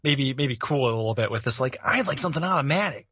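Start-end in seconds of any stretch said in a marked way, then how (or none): 1.8-3.1 put-on higher, smug kid asking; a hint of bratty teen; cocky teen voice. Mocking Steve's gun grab.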